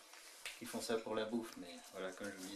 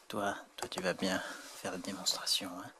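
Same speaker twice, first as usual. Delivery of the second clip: ordinary talk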